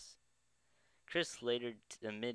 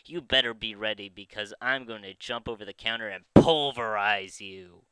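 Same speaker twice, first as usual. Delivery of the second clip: loud threatening shout; angry threat; 12 dB louder than usual. Acted. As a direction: yell it like you'll jump the counter. Prank call threat to Chris.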